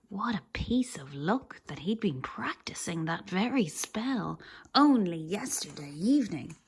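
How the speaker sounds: usual speaking voice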